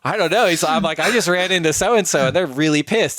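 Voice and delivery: high, loud, agitated acted quote; flustered retelling. Telling the tour manager who is angry, acted-out fluster. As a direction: replay a flustered report, pitched well up.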